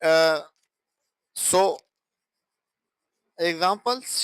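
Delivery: usual speaking voice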